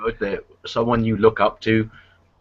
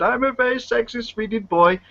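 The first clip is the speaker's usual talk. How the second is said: put-on Swedish accent; 9 semitones higher than usual. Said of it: "and he said".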